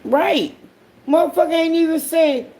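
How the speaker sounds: high, rapid indignation; worked-up rant. Real, not acted. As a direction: keep building the outrage. Ranting in disbelief.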